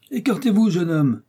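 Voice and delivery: high, forceful order to stand aside; commanding shout. A narrator's reading. Jussac ordering the young man out of the way, commanding shout. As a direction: call out loud and high. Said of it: "cria Jussac".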